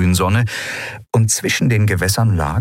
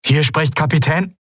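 high, strained radio voice; static-broken distress call; 9 semitones higher than usual. A narrator's reading. Captain identifies himself to anyone listening, high static-broken call. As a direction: call through heavy static. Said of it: "Das Rauschen war so stark".

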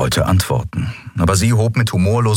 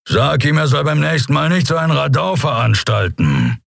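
knurrend unbeeindruckt; 8 semitones higher than usual. A narrator's reading. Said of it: "knurrte er unbeeindruckt".

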